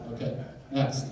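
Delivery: ordinary talk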